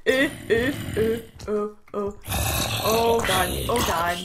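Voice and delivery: ordinary talk, nothing marked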